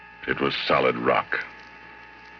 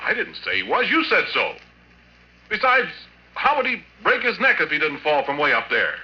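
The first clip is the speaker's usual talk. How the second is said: defensive retort; 12 semitones higher than usual. Acted. Interrogated by police.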